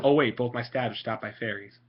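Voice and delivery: ordinary delivery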